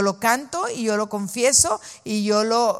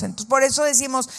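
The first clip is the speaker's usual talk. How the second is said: ordinary talk, nothing marked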